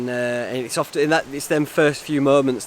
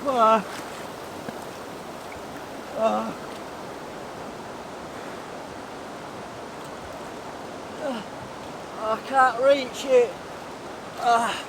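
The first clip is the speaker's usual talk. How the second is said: higher strained grunts; stretching for the rope, cold; straining in cold water; 7 semitones higher, 7 dB quieter, slower than usual. Real, not acted.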